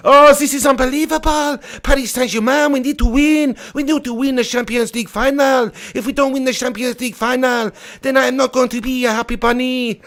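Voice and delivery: high-pitched mock French accent. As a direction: put on a hammy, high French voice. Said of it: "There's my French accent for you".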